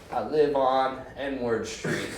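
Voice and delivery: very slow dragged-out slur; mock mangled drunk mumbling his address; barely conscious drunk. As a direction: drag each word out half asleep. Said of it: "basically almost in corpse form".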